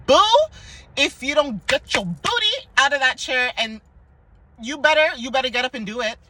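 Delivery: bossy auntie telling you to get up; tough-love scolding. Pretend. To a doubtful commenter, sassy tough-love push. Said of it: "I'm gonna look at you and be like".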